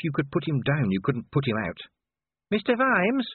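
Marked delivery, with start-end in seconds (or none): 2.5-3.3 high, urgent call; Cheery. A narrator's reading. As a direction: call the boss over in a high, anxious voice. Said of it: "beckoning urgently".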